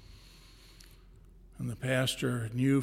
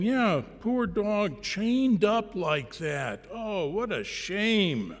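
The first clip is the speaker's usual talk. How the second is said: pitying pastor; 7 semitones higher, slower than usual. Acted. Pastor pities the chained dog.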